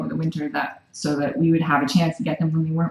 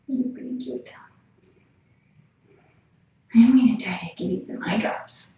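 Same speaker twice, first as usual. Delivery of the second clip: whisper; 2 semitones higher than usual. Real, not acted. Whispering to the sick cat.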